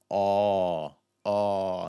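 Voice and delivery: long open "aw"; drawn-out model "aw" vowel; open stressed O. Shown as a demonstration. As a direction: hold "aw" with an open, rounded mouth. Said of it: "the open stressed sound".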